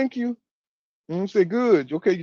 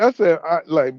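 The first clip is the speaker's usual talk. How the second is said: ordinary talk, nothing marked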